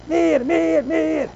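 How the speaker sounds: put-on crying baby. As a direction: wail like a hungry newborn. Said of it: "die begint te blaren".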